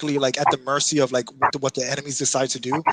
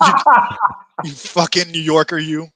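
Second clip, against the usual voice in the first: mock angry rant; 3 semitones higher, 6 dB louder, slower than usual. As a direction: playfully yell a fake tirade. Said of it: "He's like".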